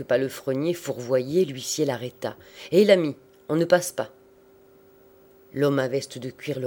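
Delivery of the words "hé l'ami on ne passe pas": usher's challenge. A narrator's reading. Usher stops a supposed stray groom.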